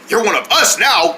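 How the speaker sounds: ordinary talk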